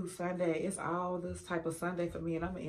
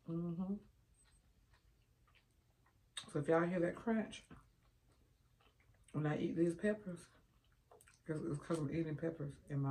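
talking between bites; chewing while talking. Real, not acted. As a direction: mouth partly full. Seen 1: Spoonful of beans at -2; closed, working mouth while stirring through clip.

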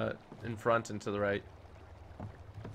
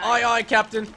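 pirate crew reply; 9 semitones higher, 7 dB louder, slower than usual. Pretend. Playful reply to teammate.

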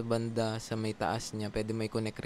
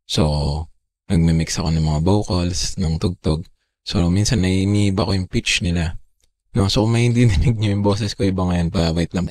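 pitch-shifted, much louder, lower processed voice; voice changer effect; 4 semitones lower, 15 dB louder than usual. Real, not acted. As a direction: talk through a voice changer set lower and loud. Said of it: "ito yung normal boses ko".

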